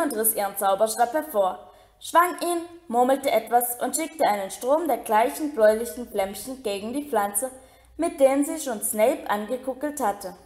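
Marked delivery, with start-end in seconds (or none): none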